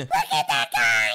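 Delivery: strained yell of alarm; alarmed shout. Pretend. Gamer spotting a monster, alarmed shout. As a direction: cry out at a shocking sight.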